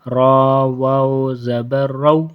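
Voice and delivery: long, chanted "را" with a full, heavy r; thick ra. Shown as a demonstration. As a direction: chant slowly, making "را" thick. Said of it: "تو اس لیے را کو پر پڑھیں گے".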